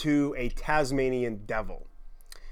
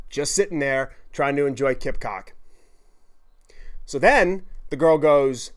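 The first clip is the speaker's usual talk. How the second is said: ordinary delivery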